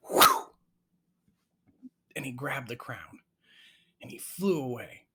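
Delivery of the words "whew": high, loud whistled whoosh. Acted. Storyteller mimics raven swooping down very quickly, high whoosh. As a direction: imitate a fast swooping dive.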